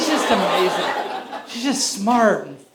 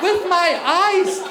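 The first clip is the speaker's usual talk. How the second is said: slow emphatic repeat; 7 semitones higher, slower than usual. Real, not acted. Comic repeated punchline.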